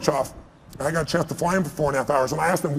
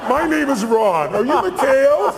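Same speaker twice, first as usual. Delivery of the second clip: laughing Reagan imitation; 8 semitones higher, 4 dB louder, slower than usual. Reagan reenacted, teller laughing.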